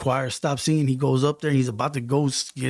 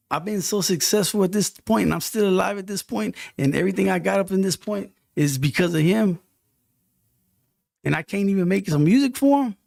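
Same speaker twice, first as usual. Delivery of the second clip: impassioned self-talk; 8 semitones higher, 5 dB louder than usual. Retold or imitated. Telling himself he must make music for the Lord, rising impassioned. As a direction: pitch rising high and louder, fired up.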